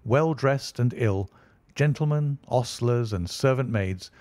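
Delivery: usual speaking voice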